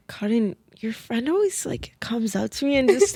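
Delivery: near-whispered imitation of a kid; mock confiding. Little sister reports on a strange friend, hushed and confiding.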